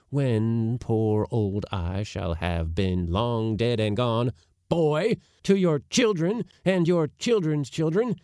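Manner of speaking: indignant oration. A narrator's reading. Uncle offering to put his nephew ashore, indignant. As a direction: build a pompous ultimatum, phrase by phrase.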